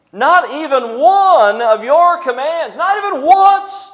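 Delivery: elder son boasting to his father, self-righteous. Acted. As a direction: insist on your perfect record. Voicing son's self-righteous boast.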